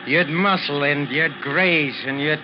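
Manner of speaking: loud, with bursts of laughter between phrases; laughing reminiscence. Acted. Maestro ignoring Ricky's discomfort to reminisce, laughing. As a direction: keep breaking into laughter as you recall. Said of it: "I must laugh when I think".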